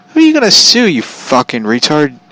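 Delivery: high, loud angry jab at the neighbor; angry retort. Retold or imitated. Mocking the biker's threat to sue, high and loud. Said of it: "I'm like".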